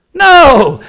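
high, loud, drawn-out no; emphatic refusal. Real, not acted. Preacher rejecting a false notion before church, loud and emphatic. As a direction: shout down the notion in one long burst. Emphatic answer to own question.

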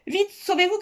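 high-pitched urgent cry; urgent, high warning call. A narrator's reading. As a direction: cry out to make them flee. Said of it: "cria l'homme en uniforme bleu ciel".

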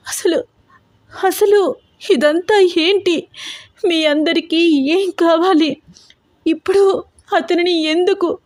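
high, tearful, pleading cries; frightened girl crying through her questions. A narrator's reading. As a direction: sob the questions out in panic. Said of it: "గట్టి గట్టిగా ఏడుస్తోంది హారిక".

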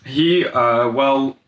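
halting, broken-up false starts; hesitant stalling. Acted. Son invents a cover story for dad, hesitant stalling. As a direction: grope for an excuse, pausing between fillers.

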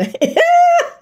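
high, loud burst of laughter; real helpless laugh. Real, not acted. Laughs every time she thinks about it, high loud laugh. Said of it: "I laugh every time I think about it".

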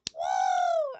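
loud high whoop; excited cheer. Real, not acted. Streamer cheering hello.

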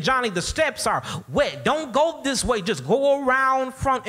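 mock call to a child; retold holler. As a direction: call out the warning, imitating a worried neighbor. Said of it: "she said again".

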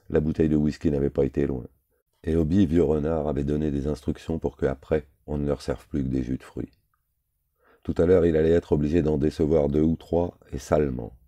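ordinary delivery

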